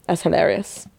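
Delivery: quiet throwaway; soft aside. Real, not acted. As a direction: murmur it to yourself. Repeats the remark, second louder.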